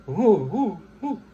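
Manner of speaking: high, loud, panicky mock alarm; imitating someone suddenly scared of the angry guy; mock frightened. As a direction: play a startled bully backing off, hammed-up. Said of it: "'cause then people go".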